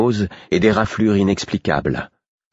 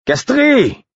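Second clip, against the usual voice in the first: appel lointain; 6 dB louder than usual. A narrator's reading. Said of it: "La voix du garde allait s'affaiblissant".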